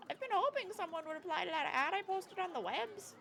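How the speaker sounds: put-on high, quavery old lady. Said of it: "I tried to do an old lady voice".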